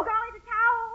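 normal talking voice

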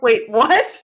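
high, laughing double take; amused bafflement. Retold or imitated. Manager hearing rep's word for parentheses, baffled and amused. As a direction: replay a confused reaction through a laugh.

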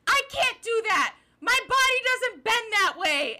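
high, loud mock protest; imitated screaming. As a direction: yell it like a hammed-up victim pleading reason. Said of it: "Gibby is screaming the most reasonable things".

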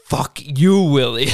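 loud mock-furious curse; retold angry shout; angry outburst. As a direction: act out a coworker blowing up, loud. Retold fight, Bob cursing Willie.

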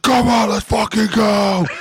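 high, drawn-out battle yell; imitated hype shout. Spotter standing over lifter on the bench, hype shout. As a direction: roar it slowly at the lifter.

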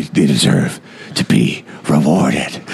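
low, slow, dramatic Batman voice. Acted. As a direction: drop low and draw out each phrase.

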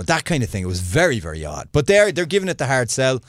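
ordinary talk

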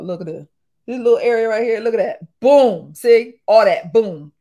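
loud, punchy showing off; emphatic pointing. Real, not acted. Showing viewers her breakouts, loud and punchy. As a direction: point and punch each word.

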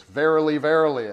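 loud, high solemn verily; emphatic God voice. Acted. God calling his people to listen, loud and emphatic. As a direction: boom out verily like God commanding. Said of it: "It's, it's emphasis".

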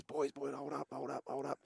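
soft, urgent hold-up to teammates; hushed call. Retold or imitated. Hiding the breather behind the fence.

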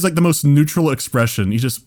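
normal talking voice

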